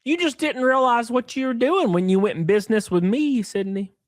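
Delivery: high, smug mock voice of the partner; mocking the business partner. Mocking the partner who robbed Sydney, high smug voice. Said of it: "he said".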